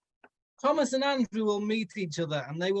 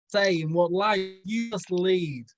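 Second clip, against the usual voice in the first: usual speaking voice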